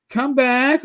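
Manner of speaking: lightly mocked calling voice; Rach calling back. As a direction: mimic someone calling you over. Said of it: "when I hear Rach go".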